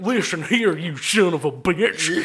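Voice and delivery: slurred, whistling s through the teeth; slow Texan threat with teeth in it; toothy drunk Texan. Acted. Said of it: "let's throw some teeth in it".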